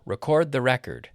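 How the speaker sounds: first RECORD re-CORD, second REC-ord; loud re-CORD the REC-ord; contrasting syllable stress. Shown as a demonstration. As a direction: stress verb RECORD late, noun RECORD early. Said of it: "verb gets the stress on the second".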